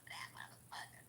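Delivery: unvoiced whisper of the insult; whispered aside. Real, not acted. To her viewers, whispering a jab at the ex.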